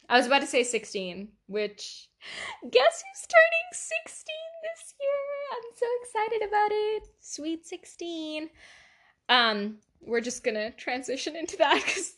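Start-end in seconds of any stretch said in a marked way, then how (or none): 2.7-7.0 high, squealing excitement; excited squeal. Real, not acted. Teen tells podcast listeners she turns sixteen, squealing high with excitement. 7.2-8.5 dreamy drawl. Real, not acted. Drawn-out birthday phrase.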